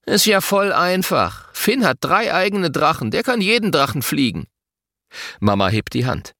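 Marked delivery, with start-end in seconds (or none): 0.0-4.5 put-on higher, dismissive child's scoff; snooty schoolboy boast; scornful. A narrator's reading. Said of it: "schnaubt verächtlich".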